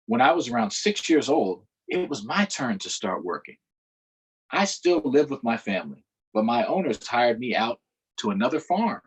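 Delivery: steady read-aloud storytelling voice; story narration. A narrator's reading. Teacher reading a book aloud to children, narrating. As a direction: read the story to the class, measured.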